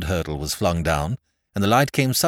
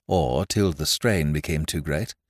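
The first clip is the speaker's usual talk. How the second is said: ordinary delivery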